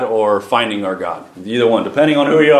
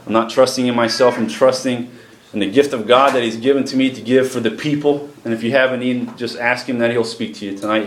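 normal talking voice

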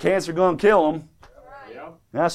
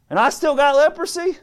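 high, rising mock complaint; incredulous Naaman; 8 semitones higher than usual. Preacher as Naaman after dunking one time, incredulous. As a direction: protest in disbelief, pitched up.

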